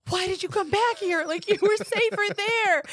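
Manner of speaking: high, loud imitation of a shocked mother-in-law; alarmed mother-in-law. Mother-in-law scolding her for coming back, high and loud. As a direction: shriek it like a frantic in-law.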